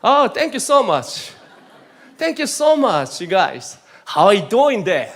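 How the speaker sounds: high, slow drawn-out American thank you; American style. Pretend. Preacher playing the American to the congregation, high and slow. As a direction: ham up the gushing American. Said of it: "오 번 미국 사람 같다".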